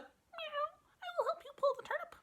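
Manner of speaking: very high, fast kitten voice. Acted. The kitten offering help, very high and quick.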